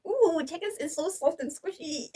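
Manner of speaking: high, bright squeal of delight. Real, not acted. First bite of soft citrus.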